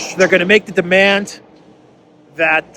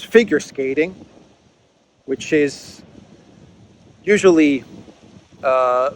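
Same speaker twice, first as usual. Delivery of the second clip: halting, drawn-out phrases with long pauses; slow and careful. Real, not acted. Host wary of how he words a touchy skating rule, slow and careful. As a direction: pick each phrase very slowly and cautiously. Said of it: "I'm speaking very slowly and very carefully".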